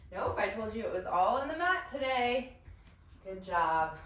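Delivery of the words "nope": normal talking voice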